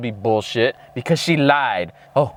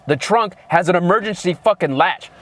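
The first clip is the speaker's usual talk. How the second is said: ordinary delivery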